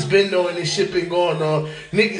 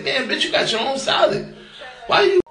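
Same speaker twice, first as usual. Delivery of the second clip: annoyed outburst; 6 semitones higher than usual. Retold or imitated. Man retells snapping at his date over salad, mock exasperated yelp. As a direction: act out the irritation at someone eating your salad. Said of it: "That pissed me off in salad where it's like".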